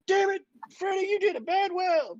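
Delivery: high-pitched hammed-up scolding; mock angry outburst. Welder blames Freddy on a spoof show, mock angry.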